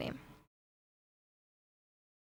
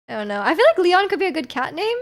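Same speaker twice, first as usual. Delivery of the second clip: ordinary talk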